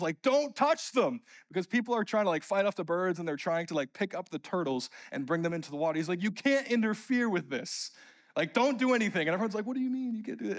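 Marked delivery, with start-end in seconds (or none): 0.2-1.2 high, urgent park ranger's warning; retold yell. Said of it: "the park ranger yells". 6.2-9.2 high-pitched, adamant warning; retold ranger's yell. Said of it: "the park ranger yells". 9.7-10.6 high-pitched incredulous question; retold protest. Crowd protesting to the ranger.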